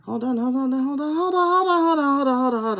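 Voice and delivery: rising, high, urgent repeated hold on; excited hold on. Real, not acted. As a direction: stop everything, it's big news. Stopping at a startling card.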